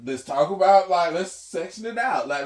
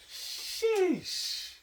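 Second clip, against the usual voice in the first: high sheesh. Retold or imitated. Reacting to grandma cooking.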